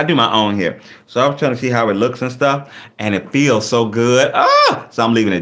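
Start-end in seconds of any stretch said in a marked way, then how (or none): 4.3-4.8 high squeal. Real, not acted. Sudden exclamation.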